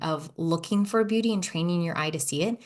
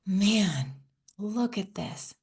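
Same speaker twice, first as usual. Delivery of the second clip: quiet awe; much slower than usual. Retold or imitated.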